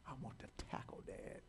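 unvoiced whisper; son whispering. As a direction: whisper it like an eager boy. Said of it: "he was like".

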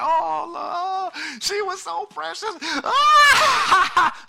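high, loud hammed-up wail ending in a cry; mock wailing mourners. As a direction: imitate the weeping crowd, over the top. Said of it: "everybody's crying. Everybody's weeping".

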